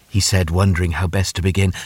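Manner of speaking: usual speaking voice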